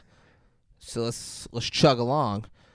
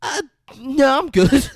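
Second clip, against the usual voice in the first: high, loud imitation of a girl declining; girl's awkward refusal; 12 semitones higher, 7 dB louder, slower than usual. Girl declining an older man's lap, high-pitched mock refusal.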